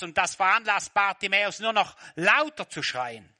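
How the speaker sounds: normal talking voice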